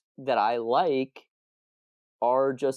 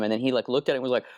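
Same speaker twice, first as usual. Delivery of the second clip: ordinary talk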